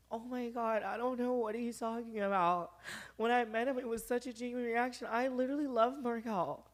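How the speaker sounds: high, whiny fake-teary voice; mock crying influencer. Imitating her livestream plea to fans, mock teary and high. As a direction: mimic a girl crying on her live. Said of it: "she went on her live and was crying".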